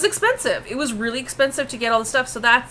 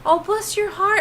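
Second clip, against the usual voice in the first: sincere sweet; 11 semitones higher than usual. Retold or imitated. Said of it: "I actually meant it sincerely".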